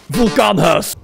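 Vulcanus shouted the Dutch way, VUL-ka-nus; Dutch pronunciation. Shown as a demonstration. Streamer switching to Dutch for the name, a shouted Vulcanus. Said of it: "I'll just say it in Dutch".

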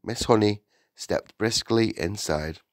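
ordinary delivery

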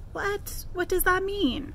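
high, bewildered what; puzzled and taken aback; shocked question; surprised. Pretend. Planets confused by reply.

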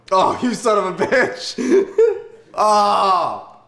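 high, loud laughing curse; a laughing shout of disbelief; laughing outburst. Real, not acted. Surprised by nineteenth butter.

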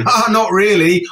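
forced "ha ha ha" before a high "not really"; mock laugh. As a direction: laugh it off sarcastically.

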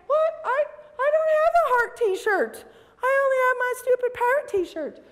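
hammed-up self-pity; cartoon lonely whine; mock whimper. Left-out pirate fan.

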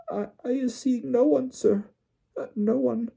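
very high, squeaky timid elf voice; a scared servant stammering; frightened house-elf. Acted. Winky answering Mr Weasley, timid squeaky elf.

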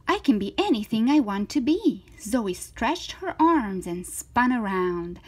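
lively, swooping story read; reading to children. Acted. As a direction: voice an eager, confident little girl. Reads to children, voicing Zoe.

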